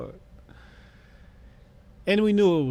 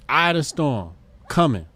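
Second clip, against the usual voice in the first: ordinary delivery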